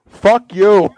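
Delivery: loud mock-angry comeback; defiant retort. Shirt thief to staff asking for receipt, loud defiance. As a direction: throw it back loudly, as an acted brush-off.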